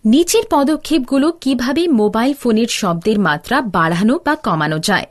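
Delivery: ordinary talk, nothing marked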